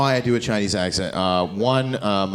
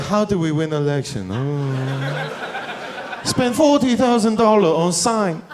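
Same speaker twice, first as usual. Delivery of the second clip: mock Chinese accent; 5 semitones higher, slower than usual. Mock Chinese official quote.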